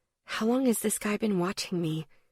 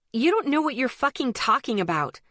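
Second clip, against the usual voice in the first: higher, clipped-off angry retort; bitten-out anger; 5 semitones higher than usual. A narrator's reading. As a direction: bite each word out in anger. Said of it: "I bit out".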